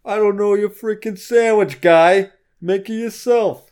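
hammed-up Northeastern customer screaming at the worker; Northeastern shout. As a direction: bellow at the sandwich guy in a thick Northeastern accent. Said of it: "Your accent there".

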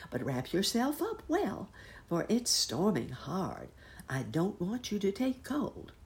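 high, caring muskrat lady voice; fussing housekeeper. Acted. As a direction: fuss over him like a worried housekeeper. Muskrat housekeeper fussing over bunny.

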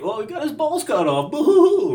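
high mock boo-hoo, hammed up; mocking sob. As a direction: fake crying, dismissive. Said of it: "No one died like".

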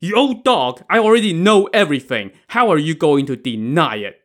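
high, loud, rushed accusation; angry roar. Acted. Brother confronts lying old woman, angry roar. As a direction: roar it out, racing through the middle. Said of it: "Wu Song roared".

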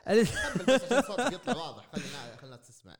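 high, loud laughing through the words; real laughter bursting through the request. Real, not acted. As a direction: laugh it out while teasing him. Laughing while urging louder mic.

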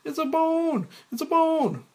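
put-on very high, loud children's cry; shouting kids. Kids shouting back at Mike, high loud chorus. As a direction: call it out like excited kids. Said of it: "Kids shouting".

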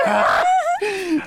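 asombro actuado muy agudo; grito agudo de asombro. Retold or imitated. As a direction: chilla de sorpresa al verla. Imita su reacción al verla. (recreando su shock al ver a Paris, grito agudo)

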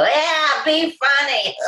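high, drawn-up mock cheer; lone heckler. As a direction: imitate a solitary late-night heckler. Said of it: "have one person go".

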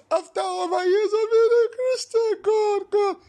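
very high, loud, crying screech; mock crying wail. Pastor mimics a believer wailing to God, high crying screech. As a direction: wail God's name like a sobbing believer. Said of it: "emotional blackmail".

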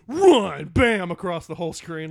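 loud, high blast on RUN, then punchy; big mock-dramatic yell; booming title-card shout. Shown as a demonstration. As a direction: shout it like a giant word slamming onscreen. Mimics a giant title card.

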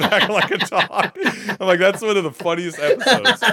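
laughing through the words, high and loud; helpless laughter breaking into speech. Real, not acted. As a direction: crack up as you talk. Cracking up retelling a sitcom scene.

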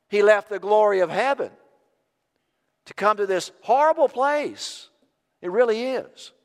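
impassioned high preaching voice. Acted. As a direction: preach it loud and high with passion. Preaching at full force.

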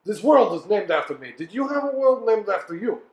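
proud, booming lord proclaiming himself; boastful ghost lord. Acted. GM voices ghost Valos.

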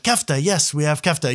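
suddenly understanding, call it back loudly; vendor's eager reply. Retold or imitated. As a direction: act out an eager yes. Acts out vendor's relieved reply.

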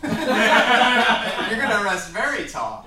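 high, loud words through laughter; laughing. Real, not acted. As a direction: laugh it out in disbelief. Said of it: "laughing at the player".

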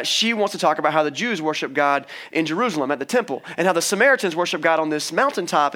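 usual speaking voice

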